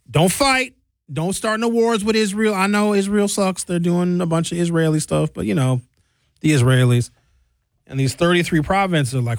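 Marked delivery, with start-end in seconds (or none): 0.1-7.1 mock supreme leader. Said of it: "But the supreme leader was like".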